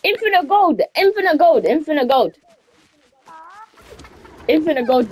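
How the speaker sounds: high, excited squeal. Real, not acted. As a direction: squeal about the gold. Excited over golden chicken.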